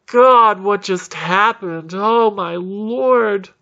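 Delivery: high, drawn-out wail of overwhelmed relief; mock sobbing. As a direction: imitate weeping survivors, gasping, slow and high. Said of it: "people are cheering and crying and hugging".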